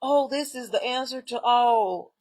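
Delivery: slow, drawn-out fake wonder; mocking an awestruck person; mock awed believer. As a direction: mimic a dazzled believer, slowly.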